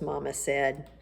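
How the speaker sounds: usual speaking voice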